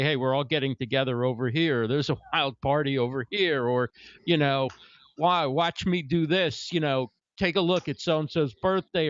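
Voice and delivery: normal talking voice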